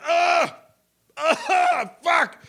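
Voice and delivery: straining like a dog reaching for food; straining grunts. Retold or imitated. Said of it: "Just like".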